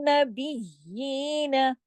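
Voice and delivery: "नबिय्यीन" slow with full nasal ghunna; recitation demo. Shown as a demonstration. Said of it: "इसका पूरा गुन्ना अदा करना है".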